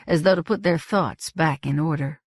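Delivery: ordinary delivery, nothing marked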